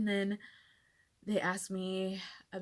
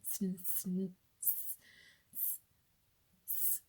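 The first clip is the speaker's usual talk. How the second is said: stuttering; 7 dB quieter, slower than usual. Retold or imitated. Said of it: "I'm just stuttering".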